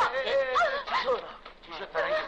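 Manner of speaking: high-pitched startled cries; suddenly panicked for her; alarmed. Acted. Man cries out in alarm when Farangiz collapses.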